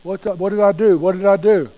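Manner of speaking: worried dog protesting, rising to loud; urgent, bewildered dog voice; cowed dog. Acted. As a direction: plead like a dog wrongly told off. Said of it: "backs up and goes".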